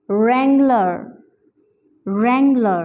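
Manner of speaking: wrangler said "rangler", no w; silent w in wrangler. Shown as a demonstration. Teacher introducing wrangler with silent w. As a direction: begin wrangler on r. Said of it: "डब्ल्यू ... silent है".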